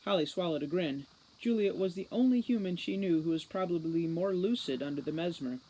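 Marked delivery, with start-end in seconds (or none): none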